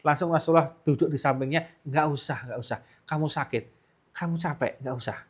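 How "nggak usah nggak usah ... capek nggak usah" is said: soft, hushed, gentle reassurance to a sick wife; husband quietly soothing his ailing wife, hushed and tender. Acted. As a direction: ease her back down softly, almost a murmur. Prophet gently stops sick wife.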